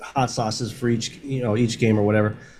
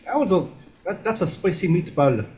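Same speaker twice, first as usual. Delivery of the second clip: put-on Italian accent; 6 semitones higher than usual. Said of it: "you're doing bad Italian accents".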